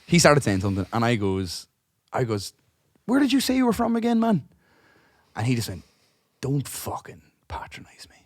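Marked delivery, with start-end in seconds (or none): 3.1-4.5 overly friendly question. Retold or imitated. Trying to smooth things over. 6.4-8.2 a soft, menacing whispered snap; hissed whisper. Retold or imitated. Drunk man snaps at him.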